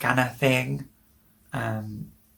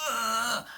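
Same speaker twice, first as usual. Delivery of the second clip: high, soft strained groan; straining groan; 10 semitones higher, 8 dB quieter than usual. Retold or imitated. Patient on the toilet trying to pee, straining groan. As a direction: push out a high "ugh", held back in volume. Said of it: "straining to".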